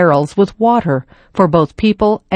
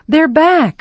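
yelled; 10 semitones higher than usual. Acted. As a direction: shout the news to everyone. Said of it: "someone yelled".

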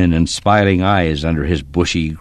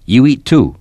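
commanding; 5 semitones higher, 6 dB louder than usual. A narrator's reading. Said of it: "it was an order".

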